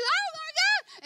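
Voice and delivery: high, slow panicked screaming cry; high drawn-out crowd scream; mock scream. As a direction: shriek it like a crowd in chaos. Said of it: "people are screaming".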